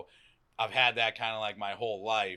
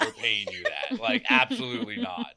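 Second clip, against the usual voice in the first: laughing refusal; 3 semitones higher, 6 dB louder than usual. Retold or imitated. Employer refusing, laughing, high.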